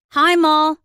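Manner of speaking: put-on high, loud little boy's greeting; small child calling out, pitched high; child piping up. A narrator's reading. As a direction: pipe up like a kid in the background. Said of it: "he piped up in the background".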